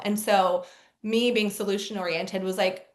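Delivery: normal talking voice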